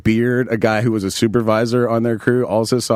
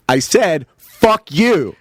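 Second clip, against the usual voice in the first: I SAID, FUCK YOU with each word set apart, pitched up; each word precisely stated; 6 semitones higher, much slower than usual. Retold or imitated. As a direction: imitate a deliberate repeat, spacing out FUCK and YOU. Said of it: "precisely stated".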